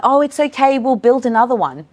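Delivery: breezy high brush-off; chirpy, wave the upset away; dismissive. Shown as a demonstration. Educator brushing off a child's fallen tower, dismissive.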